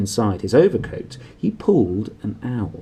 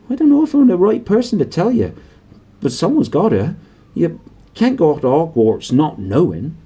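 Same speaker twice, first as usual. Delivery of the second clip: anxious Hagrid; 4 semitones higher, 5 dB louder than usual. A narrator's reading.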